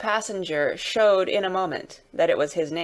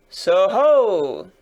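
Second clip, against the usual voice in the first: long, sung-out bellow; much louder hailing shout; loud roar; 8 dB louder than usual. Acted. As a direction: roar out a challenge. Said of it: "the guard sang out, as loud as he could roar".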